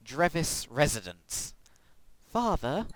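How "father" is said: put-on high posh English boy; lightly posh, raised pitch. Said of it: "ridiculous posh twit".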